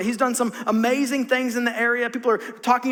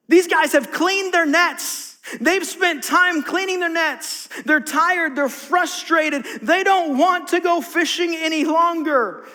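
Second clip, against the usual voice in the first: higher, slow, pressing sermon delivery; emphatic preaching; 6 semitones higher, 3 dB louder, slower than usual. Acted. Preacher stresses the fishermen's fatigue, emphatic. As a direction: drive each line home to the congregation.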